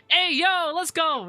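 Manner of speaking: excited. Real, not acted. Excited hype shout at chat.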